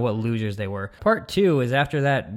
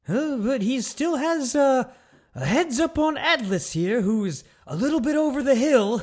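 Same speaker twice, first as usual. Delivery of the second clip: announcer, impersonated much higher; mock announcer voice; 12 semitones higher than usual. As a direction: squeak out the put-down like a cartoon announcer. Imitating the announcer Brain.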